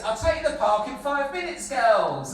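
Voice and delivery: high sing-out that falls on the last word; imitation of Granddad. Kids imitate Granddad avoiding the park, put-on promise. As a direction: put off the children in a lightly imitated voice. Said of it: "can you do an impression of Ants?".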